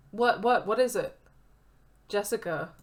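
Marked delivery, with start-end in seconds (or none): 0.1-1.2 loud, high, panicked asking; alarmed. Acted. Friend panicking at bad news, loud and high. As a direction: demand the news urgently.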